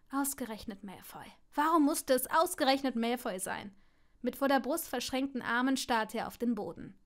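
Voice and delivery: ordinary delivery, nothing marked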